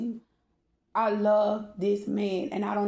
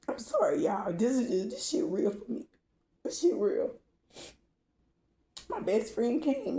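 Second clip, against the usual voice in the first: choked up. Real, not acted. Breaks down telling her story.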